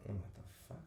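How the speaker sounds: whispered, low-key disbelief; barely audible mock bewilderment; mock stunned whisper. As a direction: whisper it as an imitated stunned aside. Imitating character's stunned reaction.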